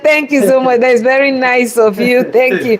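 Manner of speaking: thanking through bursts of laughter; real laughing thanks. Real, not acted. Chef thanks viewer for praising her French, laughing. Chef amused by compliment on accent.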